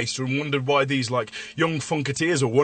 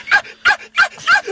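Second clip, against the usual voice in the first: shrill comic whoop, much higher and louder; high yelping cry; 4 dB louder, faster than usual. Acted. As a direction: yelp it out like a madman.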